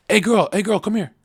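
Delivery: calling out flirtatiously. Pretend. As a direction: beckon her over from down on one knee.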